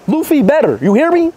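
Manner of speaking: ordinary talk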